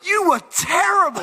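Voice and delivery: high-pitched imitation of blunt Australian moviegoers; Australian critics. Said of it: "whenever anyone comes to us from Australia".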